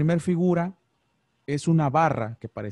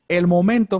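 emphatic loud; 5 semitones higher, 11 dB louder than usual. Real, not acted. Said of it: "fíjate bien".

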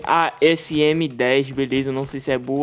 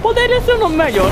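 high squeak; 5 dB louder than usual. Real, not acted. Jokes about his own name.

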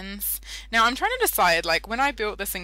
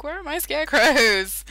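surprised; 7 semitones higher, 7 dB louder than usual. Retold or imitated. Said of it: "I was like".

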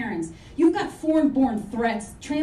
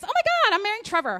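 bride; 7 semitones higher, much faster than usual. Retold or imitated.

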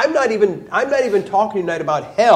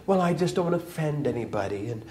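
mock timid excuse; 2 semitones lower, 8 dB quieter, faster than usual. Mocking timid politician's excuses.

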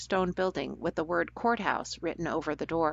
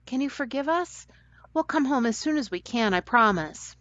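put-on higher girl's voice, apologetic and homesick; pleading Delia; 6 semitones higher than usual. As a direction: coax the old cook into forgiving you.

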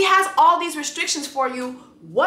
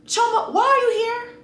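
much higher pitch, incredulous; exasperated plea; 12 semitones higher than usual. Real, not acted. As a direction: ask her in disbelief. Pleading question to Choma.

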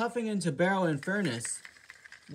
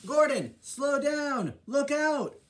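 urgent warning; 8 semitones higher, 4 dB louder, slower than usual. Acted. Scotsman warning speeding Gordon.

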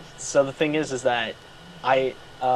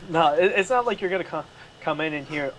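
ordinary talk, nothing marked

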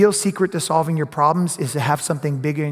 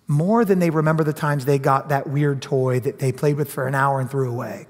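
ordinary talk

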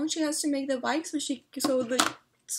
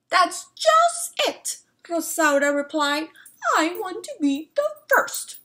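determined hen; 7 semitones higher, 6 dB louder than usual. Acted. Rosaura insisting to Señora Amelia, high and determined. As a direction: squeak it out, fiercely determined.

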